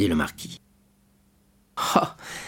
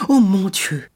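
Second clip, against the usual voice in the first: a high, louder cry of alarm; 8 semitones higher, 4 dB louder, slower than usual. A narrator's reading. René hearing of the plot, crying out in alarm. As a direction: gasp it out in shock. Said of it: "s'écria René en pâlissant".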